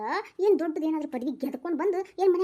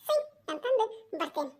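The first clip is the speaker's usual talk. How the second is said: put-on softer child voice; 4 semitones higher, 3 dB quieter than usual. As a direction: settle the kid voice down. Child voice repeating phrase. (ಮೆದುವಾದ ಮಗುವಿನ ಧ್ವನಿ)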